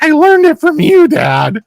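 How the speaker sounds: put-on very high, loud teen melodrama from an ad; mock anguished outburst. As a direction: yell it accusingly, hammed-up. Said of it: "those eighties commercials".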